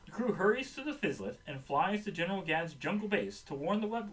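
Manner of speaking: usual speaking voice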